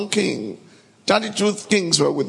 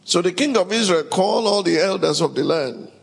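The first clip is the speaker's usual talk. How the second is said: ordinary talk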